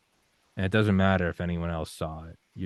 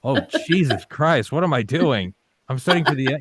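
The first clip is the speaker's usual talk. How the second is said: mock panic; 6 semitones higher, 6 dB louder than usual. Student up late panicking over medical school studying, higher and louder. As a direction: wail it like you're drowning in coursework.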